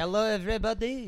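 lightly mocking French accent. Friend mimics Steve Martin's fake French accent. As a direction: greet them like a bad Clouseau. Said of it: "He's like".

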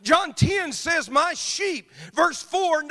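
loud, high preaching cadence; full-force preaching. Acted. Preacher thundering to the church, loud and high. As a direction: preach it hard to the congregation.

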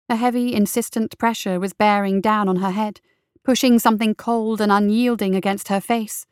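ordinary delivery

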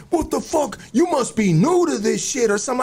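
high-pitched mock yell; retold yelling. Man imitating the one who yelled at him, mock yelling. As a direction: imitate someone yelling, voice pushed way up. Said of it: "was yelling at me".

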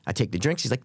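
usual speaking voice

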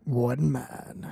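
low trailer narration; deep and grave, like a film trailer; movie trailer voice. Acted. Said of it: "like, a trailer".

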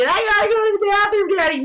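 put-on high fast whining child yell; whiny kid shout. Kid shouting in back seat.

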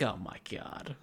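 whispered oh my God under breath; exasperated whisper. Retold or imitated. Clerk whispering in disbelief at the rude customer, exasperated whisper.

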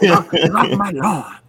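put-on angry old man. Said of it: "like an angry old man".

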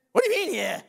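what do you mean yeah, stunned; incredulous. Real, not acted. Incredulous at flat answer.

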